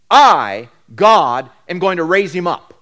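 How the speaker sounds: I and God hit hard and loud; emphatic I. Shown as a demonstration. Preacher showing the congregation the emphatic Hebrew I, loud and stressed. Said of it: "That's emphatic".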